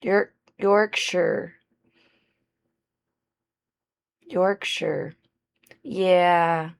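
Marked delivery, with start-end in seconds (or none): 0.0-5.1 "Yorkshire" said slowly as YORK-shuh, testing the sound; careful Yorkshire. Shown as a demonstration. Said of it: "That just feels unnatural to me".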